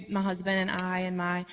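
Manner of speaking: normal talking voice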